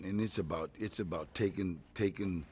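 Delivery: normal talking voice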